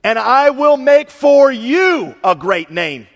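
slow, loud, high declaration; booming slow proclamation. Acted. Preacher declaring God's promise to David, slow and booming. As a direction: proclaim it slowly and grandly.

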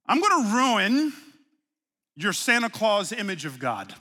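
usual speaking voice

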